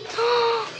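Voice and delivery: high, quick intake of breath; excited gasp. Real, not acted. Slime fan seeing a slime never tried before, excited gasp. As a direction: gasp in real amazement.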